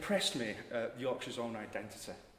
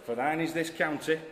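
loud, higher Yorkshire intoning; Yorkshire accent; 4 semitones higher, 6 dB louder than usual. Acted. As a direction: declaim in broad Yorkshire. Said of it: "In a Yorkshire accent".

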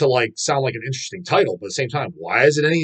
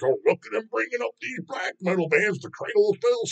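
higher whiny mock voice of a teen commenter; mocking young commenters; 9 semitones higher than usual. Vlogger mimicking a teenage commenter, higher whiny mockery. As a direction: whine it like a know-nothing kid. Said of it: "he tells me you're fifteen years old".

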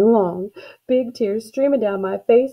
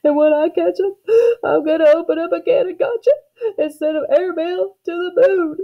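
high, loud tearful threat; crying hard; 10 semitones higher, 4 dB louder than usual. A narrator's reading. Girl threatening the thief before her family, crying hard. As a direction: bawl the threat through acted sobs. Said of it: "crying harder than ever".

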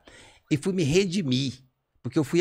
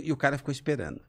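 usual speaking voice

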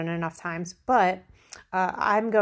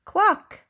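high, loud single cluck; chicken cluck; 12 semitones higher, 8 dB louder than usual. A narrator's reading. Reader voices Chicken for children, high loud cluck. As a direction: cluck sharply like a hen. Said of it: "said Chicken".

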